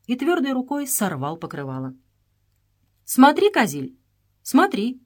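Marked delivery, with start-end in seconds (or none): none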